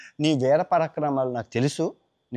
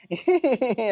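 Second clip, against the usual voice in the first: laugh; 9 semitones higher than usual. Acted. Hanuman reveals himself.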